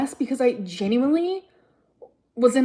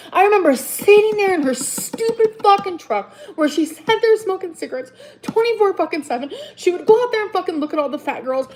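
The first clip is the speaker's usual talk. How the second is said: higher and louder, heated complaint; angry rant; 6 semitones higher, 4 dB louder than usual. Real, not acted. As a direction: let the anger rise through it.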